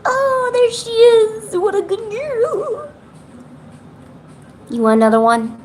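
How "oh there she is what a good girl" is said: high, drawn-out coo; cooing pet talk. Real, not acted.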